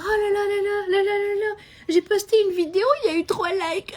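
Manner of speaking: sing-song high lament, half laughing; hammed-up sulk over only three likes; mock complaining. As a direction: whine theatrically about getting few likes. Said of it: "Et je pourrais me dire".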